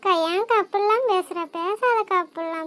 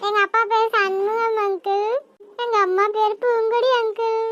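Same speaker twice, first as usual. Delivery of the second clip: put-on frightened little girl; 2 semitones higher than usual. Girl gives parents' names.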